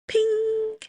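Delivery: high, held "ping" sound; phone ping. A narrator's reading. Narrator imitating a message alert, high sung ping.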